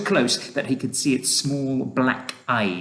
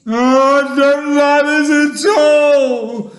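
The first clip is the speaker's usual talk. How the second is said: loud, high, drawn-out wail; distressed high-pitched howl; 11 dB louder, much slower than usual. A narrator's reading. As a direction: wail in protest, slowly and loudly. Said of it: "wailed the earthworm".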